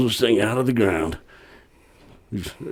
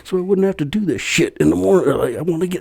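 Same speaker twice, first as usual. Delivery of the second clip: high-pitched mock griping; unhappy vault man; 9 semitones higher than usual. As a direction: imitate the annoyed worker's complaint, pitched up. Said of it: "He was not a happy camper".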